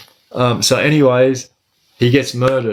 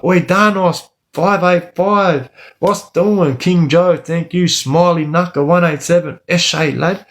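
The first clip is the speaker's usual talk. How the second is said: higher, brighter eshay shout-outs; aussie eshay slang; 5 semitones higher than usual. Real, not acted. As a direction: hype up each viewer's name. Shouting out live viewers.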